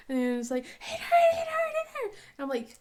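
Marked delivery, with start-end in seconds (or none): 0.6-2.1 put-on high, sobbing little boy; crying child. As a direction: wail it through tears. Son crying about his foot.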